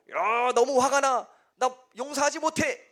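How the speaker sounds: high-pitched mock outburst of anger, opening on a drawn-out cry; acted anger. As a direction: act out being unable to forgive, pitch climbing. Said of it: "여러분, 막 분노하는 법". (화난 척 높은 목소리로)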